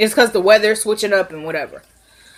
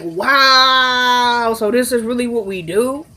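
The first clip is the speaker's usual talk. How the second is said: long drawn-out, higher-pitched wow; incredulous, hammed-up reaction; mock disbelief; 3 semitones higher, slower than usual. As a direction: stretch the wow out in put-on disbelief. Acting out own stunned reaction.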